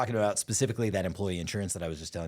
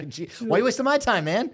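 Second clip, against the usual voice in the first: much higher, louder whiny scammer complaint; mock angry scammer; 14 semitones higher, 6 dB louder than usual. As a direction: squeal it like the outraged scammer.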